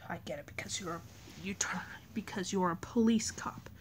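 soft unvoiced whisper. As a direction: whisper it to your friend. Kid whispers aside in play.

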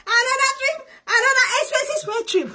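high, tearful-sounding excited cry; excited squeal. Real, not acted. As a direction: squeal it out like you can't believe it. Excited travel announcement.

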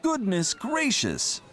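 dismayed tractor. Acted. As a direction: realize the mistake aloud. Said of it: "said Teck".

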